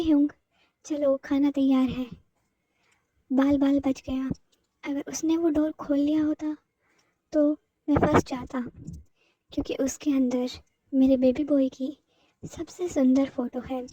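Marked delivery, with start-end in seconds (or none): none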